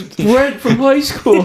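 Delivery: high, stretched-out fake recognition; Vinny. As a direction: act amazed to meet an old schoolmate. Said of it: "he did that, like, fake walk up".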